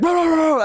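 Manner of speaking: high, frantic Chihuahua yapping; dog barking imitation. Imitating a friend's Chihuahua at the door, high yapping. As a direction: imitate a little dog going wild. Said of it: "like, bark".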